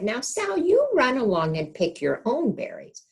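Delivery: a light touch of a patient mother; mother sends Sal off to pick, lightly voiced; mother's voice. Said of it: "Her mother stopped picking and said".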